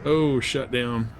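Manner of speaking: normal talking voice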